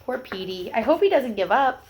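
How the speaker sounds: ordinary delivery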